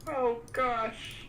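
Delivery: high, slow Kermit the Frog impression. Hearing herself on stream, she does Kermit's high slow voice. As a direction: croak it out like Kermit. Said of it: "I do sound like Kermit".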